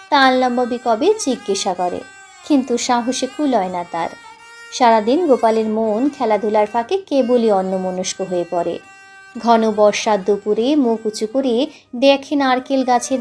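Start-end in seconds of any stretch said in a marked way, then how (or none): none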